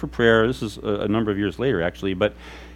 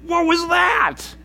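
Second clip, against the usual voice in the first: high, slow, startled call, much higher; 14 semitones higher, much slower than usual. Retold or imitated. Pastor recalling his reaction to a scream in church, high startled call. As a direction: blurt it out, stretched and shocked. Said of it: "I'm like".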